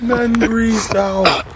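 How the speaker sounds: slow, high, stretched-out delivery; drawn out. Acted. Host savouring the boyfriend running off, in a slow drawn-out voice. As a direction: drag every word for effect. Repeats the line, first drawn out.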